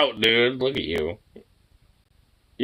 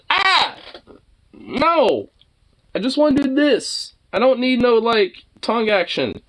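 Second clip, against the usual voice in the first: high startled protest; 14 semitones higher than usual. Real, not acted. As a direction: fend off the dog's licks. Fending off dog's tongue.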